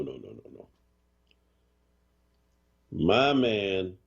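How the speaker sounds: ordinary delivery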